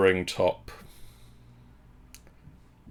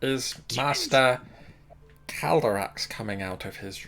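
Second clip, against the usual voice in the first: put-on, ponderous servant voice; Chris Eubank. Butler asks after the master, accidental Eubank-like voice. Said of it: "Why does he sound like Chris Eubank?".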